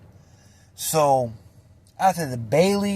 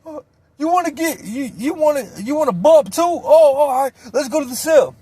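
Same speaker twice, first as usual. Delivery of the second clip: high, put-on taunting voice; squeaky bravado of a junkie; high mock tough guy; 11 semitones higher than usual. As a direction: play the fake hard dope fiend. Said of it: "Shane comes out".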